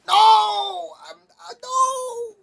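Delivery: very high yelled protest, slowing into a wail; a high, slow, cracking wail; crying shout. Retold or imitated. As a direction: howl it like a child refusing. Denying it's a bad trip.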